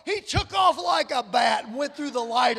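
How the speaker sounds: loud, heated telling of the driver racing off; hammed-up indignant shout; retold outburst. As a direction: let it burst out in mock outrage. Retelling a driver speeding off.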